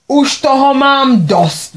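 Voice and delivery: father slamming the table, angry and slow; angry outburst. Acted. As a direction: drag each word out, furious and done with it. Father bangs fist on table.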